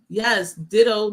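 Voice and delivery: ordinary talk, nothing marked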